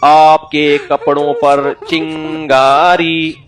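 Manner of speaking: very slow, drawn-out warning; slow tajweed recitation. Retold or imitated. Pupil slowly warning teacher his clothes are burning. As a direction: recite it like Quran lesson, no hurry. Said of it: "पूरी तजवीद के साथ ना".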